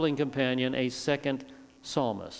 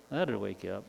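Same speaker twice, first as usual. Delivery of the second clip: lower, softer, with a laugh; quiet lower chuckle on "That'd wake you up"; chuckling, low and soft; 6 semitones lower, 8 dB quieter than usual. Shown as a demonstration. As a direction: say "That'd wake you up" again, amused under your breath.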